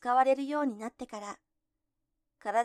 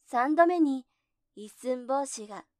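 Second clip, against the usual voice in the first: usual speaking voice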